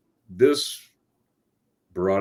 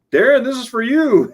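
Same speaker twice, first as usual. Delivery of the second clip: calling out; 13 semitones higher than usual. Retold or imitated. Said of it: "she's calling out my name".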